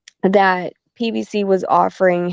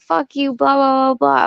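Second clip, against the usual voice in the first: high-pitched mock tirade; heated high voice, not shouted; retold cursing rant; 6 semitones higher than usual. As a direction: rattle off the insult as a re-enacted quarrel. Acting out her cursing in quarrel.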